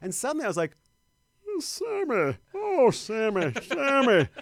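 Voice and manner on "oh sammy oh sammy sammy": high, loud crooning impression. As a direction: belt it out high like an old-time showman.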